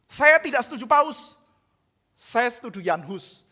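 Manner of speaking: loud, high-pitched, slowed protest; defiant loud retort. Retold or imitated. Luther loudly defying John Eck in the debate. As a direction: fire it back in defiance.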